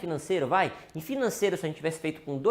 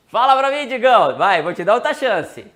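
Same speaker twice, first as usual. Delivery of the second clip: louder and higher, egging him on; loud playful tease; 5 semitones higher, 13 dB louder than usual. Real, not acted. Host loudly teasing viewer Digão to try again. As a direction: call him out with a grin in the voice.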